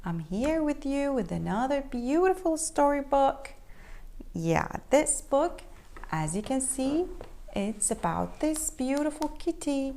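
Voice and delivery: ordinary delivery, nothing marked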